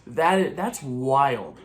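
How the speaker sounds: high, loud, slow disbelief; shocked. Real, not acted. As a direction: gasp at the big snub. Shocked by screenplay snub.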